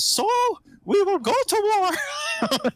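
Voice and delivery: very high, slow drawn-out proclamation; stretched-out mock war cry; pirate declaration. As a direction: imitate a comically high-voiced pirate lord. Quotes stoic pirate's war declaration.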